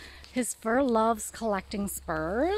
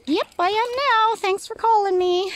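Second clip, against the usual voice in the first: voicing the dog; 11 semitones higher, 7 dB louder than usual. Voicing the dog's reply.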